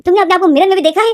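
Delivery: taunt. Acted. Mother taunting the omega.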